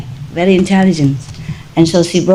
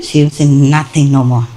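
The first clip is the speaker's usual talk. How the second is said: normal talking voice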